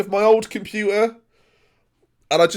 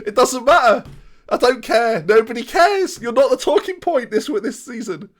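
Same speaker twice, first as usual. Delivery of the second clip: giddy gloating; 7 semitones higher, 6 dB louder than usual. Real, not acted. Gloating after title.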